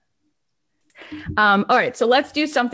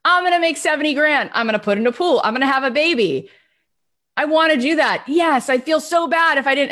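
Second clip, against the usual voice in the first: high, excited mock gush of plans; mock eager goal-setter; eager listener; 7 semitones higher, faster than usual. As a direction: rattle off big dreams brightly. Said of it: "You're like".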